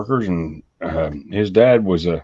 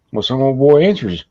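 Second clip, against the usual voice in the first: ordinary delivery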